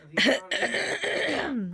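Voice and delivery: loud, high, drawn-out call. Real, not acted. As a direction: yell it across the house. Calling out from another room.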